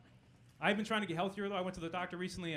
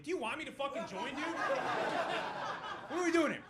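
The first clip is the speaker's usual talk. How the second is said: incredulous outburst; 13 semitones higher than usual. Retold or imitated. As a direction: squeak out your disbelief. Recruit reacts to scary statistic.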